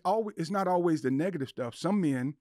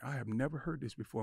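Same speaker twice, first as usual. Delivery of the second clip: quiet inner thought; 10 dB quieter than usual. Retold or imitated. Retold inner thought, much softer.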